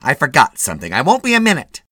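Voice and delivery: high, loud calling out; bossy wife. A narrator's reading. Wife leaving husband at the drugstore, calling out. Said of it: "she said".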